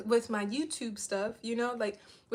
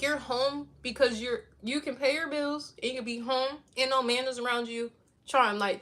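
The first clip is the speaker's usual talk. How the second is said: ordinary talk, nothing marked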